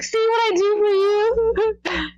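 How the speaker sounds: high, teasing see what I do; playful boast. Retold or imitated. Fan teasing Nick about her flight, high and playful. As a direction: tease him happily. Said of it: "I was like".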